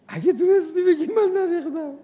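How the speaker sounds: high laughing tease; laughing challenge. Real, not acted. Preacher daring the audience to deny the spill, laughing high. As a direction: dare them through a laugh.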